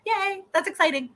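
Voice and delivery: delighted reaction to the viewer's news; excited cheer. Real, not acted. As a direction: squeal the yay up high.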